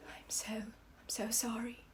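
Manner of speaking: soft whispered broken sorry; sobbing apology. Acted. Colonel clinging to narrator, apologising through sobs. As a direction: cry it out under the breath. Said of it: "starts sobbing".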